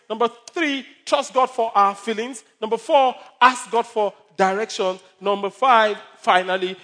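loud, rising sermon list; preaching. Acted. Pastor driving home points to congregation, preaching loudly. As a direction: preach each point with force.

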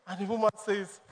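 normal talking voice

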